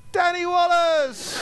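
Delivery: high, drawn-out shout of a name; stretched fan cry, pitched well up; distant fan shout. Retold or imitated. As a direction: yell the name long and high across a crowd. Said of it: "it continues".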